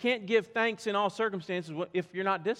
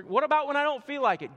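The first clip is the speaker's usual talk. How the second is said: higher, louder, fast whiny objection; mock objection; 7 semitones higher, 6 dB louder, faster than usual. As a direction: voice the complaining listener. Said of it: "imagined objector".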